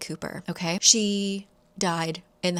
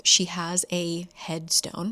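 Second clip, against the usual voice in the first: normal talking voice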